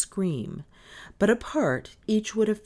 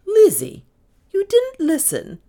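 high, loud accusing question; shocked reproof; 12 dB louder than usual. A narrator's reading. Mistress suspecting maid of eavesdropping, shocked. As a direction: catch her out, scandalised.

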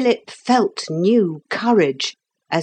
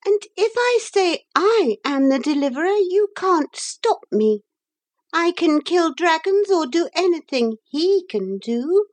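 high, thin, defiant boasting; thin, disagreeable voice; 14 semitones higher than usual. A narrator's reading. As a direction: challenge them in a high, disagreeable voice. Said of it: "a thin and disagreeable voice".

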